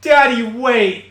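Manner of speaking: dramatic plea. Acted. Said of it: "why do I have to say it like that".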